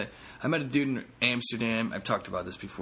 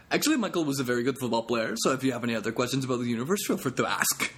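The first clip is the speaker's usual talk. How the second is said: put-on God in a Dutch accent; 2 semitones higher, faster than usual. Comic voicing God answering about his football, Dutch accent. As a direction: answer breezily as a know-it-all God. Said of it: "a response like".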